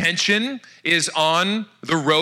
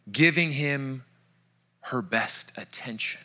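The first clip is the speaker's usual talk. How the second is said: hushed; 5 semitones lower, 6 dB quieter than usual. Real, not acted. Preaching quietly on Martha.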